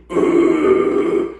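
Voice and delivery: loud raspy growl, mouth reshaped; mouth-shaped growl. Shown as a demonstration. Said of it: "then mouth shape".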